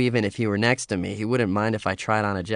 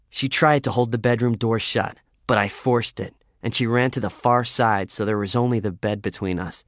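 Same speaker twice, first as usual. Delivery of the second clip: plain reading voice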